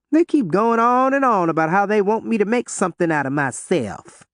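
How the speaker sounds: put-on complaining teen girl. A narrator's reading. Ruby complains.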